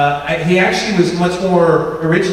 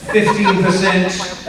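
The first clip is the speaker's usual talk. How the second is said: ordinary talk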